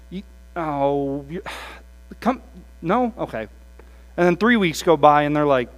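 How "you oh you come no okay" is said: half-hearted fizzle. Retold or imitated.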